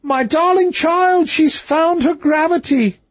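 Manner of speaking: very high, slow, screeching with delight; an old nurse screaming with joy at the princess; old nurse's joyful scream. A narrator's reading. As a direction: shriek it like an overjoyed old nurse.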